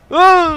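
very high, loud shriek; sharp scream. Real, not acted. Startled player shrieking at a jump scare. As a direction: let out a sudden scared shriek.

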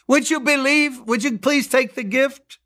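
impassioned preacher's plea; pleading appeal. Acted. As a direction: beg them to take the gift.

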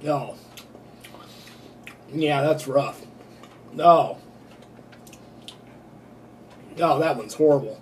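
talking while chewing; chewing a jelly bean. Real, not acted. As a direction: mouth partly full of candy. Seen 0: Puts bean in mouth at -3, grimaces and chews while speaking.

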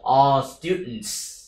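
slow, drawn-out all students; slow model phrase; slow drill. Shown as a demonstration. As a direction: say all students slowly for copying. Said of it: "slow model phrase".

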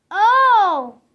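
loud drawn-out oh; light up with understanding; sudden realization. Acted. Gerald realizing Piggy speaks elephant, loud surprised oh.

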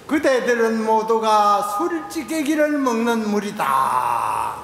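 slow, drawn-out Dharma-hall proclamation; declaimed Zen rebuke. Acted. Seon master quotes the old master's rebuke to the assembly, declaimed. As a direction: intone it loudly, stretching the last syllable long.

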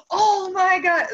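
high, drawn-out gasped-out reaction; mock shock. Fan imitating stunned crew on set, mock shock. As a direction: imitate stunned onlookers, pitched well up.

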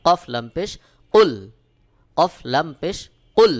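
কুল with a hard, pressed ক; emphatic qaf in কুল; firm qaf sound. Shown as a demonstration. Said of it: "আওয়াজটা শক্ত করে একটু চাপ দিয়ে উচ্চারণ করতে হবে".

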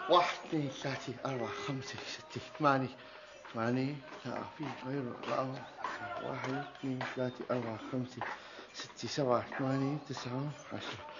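low, hushed count to ten; soft, low self-calming count. Acted. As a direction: count under your breath, holding temper in. Counts to ten to calm himself.

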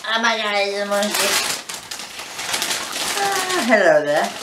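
high squeal greeting a toy; delighted opening a present. Real, not acted. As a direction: coo at the new toy.

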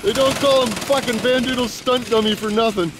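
normal talking voice

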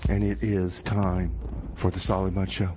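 ordinary talk

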